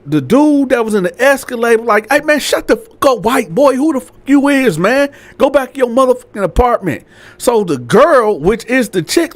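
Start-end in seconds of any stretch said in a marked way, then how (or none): 2.1-7.0 higher-pitched mock aggression; acted telling-off. Escalade driver telling landlord to go inside, mock hostile. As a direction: play an annoyed man brushing someone off.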